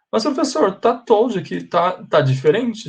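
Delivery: high-pitched puzzled student objecting. Pretend. Teacher mimics a confused student objecting, high and puzzled. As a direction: squeak it out like a confused pupil.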